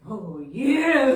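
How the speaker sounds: loud, laughing cheer. Retold or imitated. Riding the adrenaline rush, loud gleeful crowing. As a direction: crow it with a drawn-out "yeah". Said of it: "gave me that temporary high".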